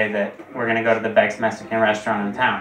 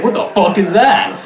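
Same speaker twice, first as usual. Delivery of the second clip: startled outburst; 8 semitones higher, 7 dB louder than usual. Retold or imitated. Blindfolded, feels wet.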